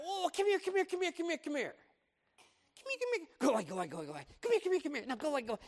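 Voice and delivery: high, sing-song calling back and forth; mock a parent who can't decide; fickle parent. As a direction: parent to crying child, high mock calls. Fickle parent to crying kid.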